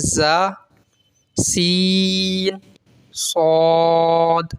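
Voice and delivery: "زا، سین، صاد" with whistling sibilants, drawn out slowly; slow recited demonstration of whistling "زا، سین، صاد"; whistling letters. Shown as a demonstration. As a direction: hold "سین" and "صاد" long after a whistling "زا". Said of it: "سیٹی کی طرح آواز نکلتی".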